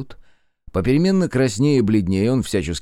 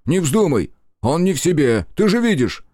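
urgent alarm; 7 semitones higher, 4 dB louder than usual. A narrator's reading. Friend urgently warns against duel.

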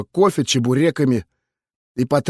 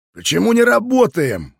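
higher-pitched snapped demand; barked question; 8 semitones higher than usual. A narrator's reading. Max to the smoking investigators, barking. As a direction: bark it at idle staff. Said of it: "рявкнул он".

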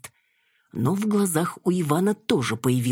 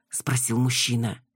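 usual speaking voice

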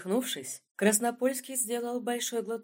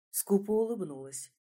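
usual speaking voice

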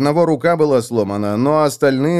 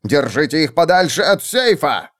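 commanding, less high; lower commanding shout; 4 semitones higher than usual. Acted. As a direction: order them firmly. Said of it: "закричал своим людям".

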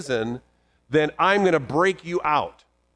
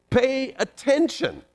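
high urgent command, stretched on attention; God crying out; 9 semitones higher than usual. Acted. Preacher voicing God's warning, high and urgent. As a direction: ring out the warning. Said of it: "God talks like that".

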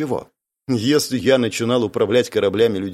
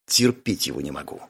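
low, soft disgusted mutter; muttered dislike; 9 semitones lower, 6 dB quieter than usual. A narrator's reading. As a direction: grumble it under your breath. Zarina mutters after he leaves.

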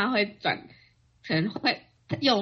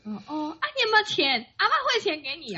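higher pitched grandma fussing; grandma's voice; 7 semitones higher than usual. Retold or imitated. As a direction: lift the voice into a worried grandma.

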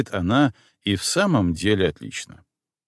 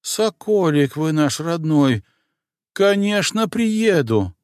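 put-on high, eager old housekeeper; doting and bustling; excited old woman; 9 semitones higher than usual. A narrator's reading.

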